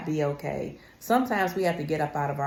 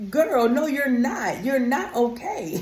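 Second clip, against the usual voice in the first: laughing retort; 7 semitones higher, slower than usual. Retold or imitated. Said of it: "and it was like".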